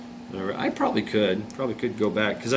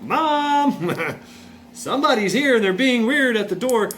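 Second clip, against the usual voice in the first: put-on high, loud, childlike call with a laugh; kid calling mom; 12 semitones higher, 4 dB louder than usual. Young boy calls his mom about strangers at the door, high excited yell.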